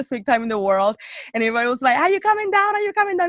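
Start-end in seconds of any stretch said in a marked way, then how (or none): 2.0-3.3 high-pitched, insistent mock nagging; impatient pestering. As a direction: imitate friends pestering someone to hurry. Everybody pestering her to come down.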